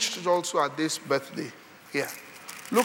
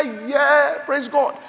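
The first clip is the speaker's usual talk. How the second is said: high, loud cheer of relief; delighted parents; 7 semitones higher, 6 dB louder, much slower than usual. Acted. Parents happy to hear the baby cry, delighted.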